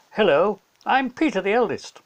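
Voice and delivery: put-on a young boy greets the listener; Peter's voice. Eldest brother introduces himself, lightly voiced boy.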